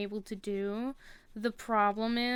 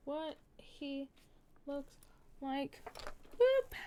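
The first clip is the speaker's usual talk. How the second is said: ordinary talk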